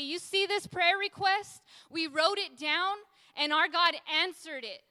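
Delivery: loud, high-pitched testimony; ringing declaration of answered prayer; triumphant proclamation. Acted. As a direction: proclaim it boldly to the room. Triumphant testimony acted out to others.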